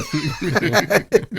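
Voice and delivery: squeaky, breathless burst of laughter; real high-pitched giggle. Real, not acted. Laughing at her reply.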